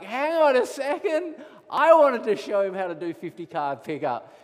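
loud, high-starting protest; child's indignant interruption. Retold or imitated. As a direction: imitate a kid butting in loudly.

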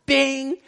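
high, sustained "bing" like a chime; imitated ding. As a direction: ring it out on one high held note. Imitates stage lights switching on.

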